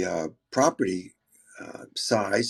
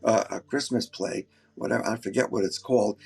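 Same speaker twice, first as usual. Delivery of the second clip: normal talking voice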